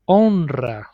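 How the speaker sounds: "honra" with R trilled after the N; Spanish "honra" with a strong trill; multiple R. Shown as a demonstration. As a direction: roll the R in "honra". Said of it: "why do we pronounce multiple R sound".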